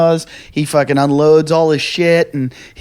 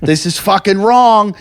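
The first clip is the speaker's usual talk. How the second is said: retold angry outburst, higher and drawn out; angry rant; 4 semitones higher than usual. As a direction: replay an indignant rant, leaning slowly on the swearing. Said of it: "I'm getting angry".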